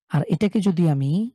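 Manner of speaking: ordinary delivery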